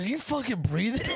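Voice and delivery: high-pitched incredulous outburst; mock exasperation. Brother laying into nephew over his breathing, mock exasperated. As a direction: imitate an appalled scolding, pitch shooting up.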